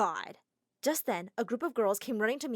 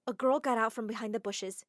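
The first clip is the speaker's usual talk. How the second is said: plain reading voice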